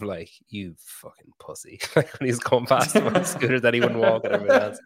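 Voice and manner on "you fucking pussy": slow, much softer put-down; mock contempt. Retelling a rival's look at scooter rider, quiet mock contempt. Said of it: "the look that Josh Bridges gave him".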